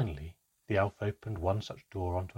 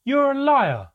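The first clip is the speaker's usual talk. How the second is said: very high, loud accusing shout; accusing cry; 13 dB louder, slower than usual. A narrator's reading. Child accuses the elf of lying, shouting. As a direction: hurl it out as an accusation. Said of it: "I cried".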